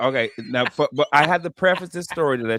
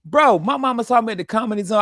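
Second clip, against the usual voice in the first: usual speaking voice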